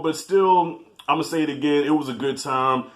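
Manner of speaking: usual speaking voice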